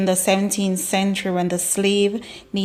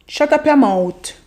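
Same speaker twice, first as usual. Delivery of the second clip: loud, higher Jamaican "Shut up your mouth"; patois telling off; 6 semitones higher, 5 dB louder than usual. Shown as a demonstration. Telling someone off loudly in Jamaican patois. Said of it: "we're at mouth".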